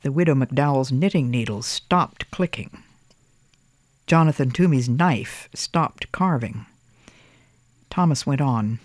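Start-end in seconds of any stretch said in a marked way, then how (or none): none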